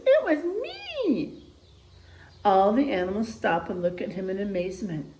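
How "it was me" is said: very high, slow squeaky voice; squeaky confession. Acted. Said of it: "in a squeaky voice".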